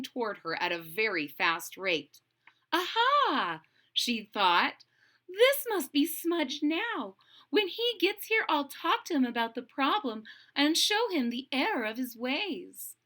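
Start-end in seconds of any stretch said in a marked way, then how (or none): 2.7-3.6 bright high realising aha; sudden eureka; excited aha. Acted. Serendipity's thought quoted. 5.3-13.0 lightly voiced heroine. Serendipity's voiced thought.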